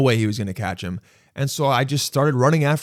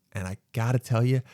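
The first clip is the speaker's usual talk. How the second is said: ordinary delivery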